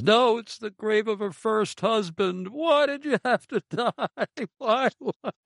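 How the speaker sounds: high, hammed-up wailing punchline, laughing through it; mock mourner's wail. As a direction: wail it high like a grieving man, cracking up.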